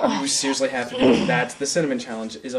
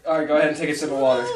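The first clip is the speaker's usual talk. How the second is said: laughing while talking fast; much faster than usual. Real, not acted. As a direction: crack up mid sentence. Laughing at coughing friend.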